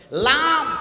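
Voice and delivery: "লাম" high and firm, tongue tip on palate. Shown as a demonstration. Preacher demonstrates the palate letter, "লাম" articulated firmly with tongue tip. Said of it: "জিহ্বার আগা উপরের তালুর মাথার সাথে লাগে".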